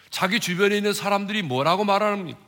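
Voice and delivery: ordinary talk, nothing marked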